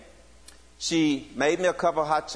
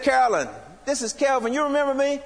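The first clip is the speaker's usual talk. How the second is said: high and eager, much higher pitch; bursting with excitement on hearing her; excited; 9 semitones higher, faster than usual. Retold or imitated. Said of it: "I got so excited".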